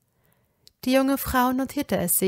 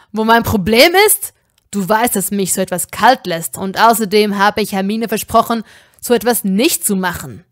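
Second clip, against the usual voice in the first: angry hiss; 10 dB louder than usual. Acted. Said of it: "zischte er zurück".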